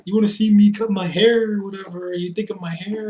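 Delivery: loud, high mock taunt; hammed-up wrestler promo, mockingly overblown; imitating Sami Callihan. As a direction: crow it loudly like a ranting heel. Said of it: "was all like".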